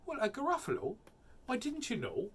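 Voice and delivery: high, lilting mouse voice; mouse character. Acted. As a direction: feign surprise as a little mouse.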